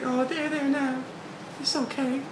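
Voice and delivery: high, slow comforting falsetto; cooing Nami voice. Retold or imitated. Nami hugging Momonosuke, high slow cooing voice. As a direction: coo like a big sister soothing a kid. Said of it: "He's like".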